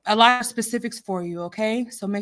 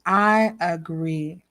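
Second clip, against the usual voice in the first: ordinary delivery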